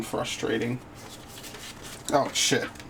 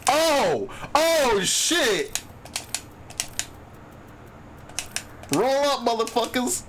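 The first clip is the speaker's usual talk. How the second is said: real gamer hype outburst; excited yelling; 11 semitones higher, much slower than usual. Real, not acted. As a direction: shout in sudden excitement at the drop. Big loot roll appears.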